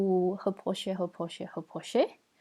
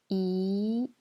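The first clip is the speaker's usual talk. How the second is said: "I" rising from mid pitch; second tone. Shown as a demonstration. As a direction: let "I" climb steadily to the end. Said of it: "je répète les quatre tons".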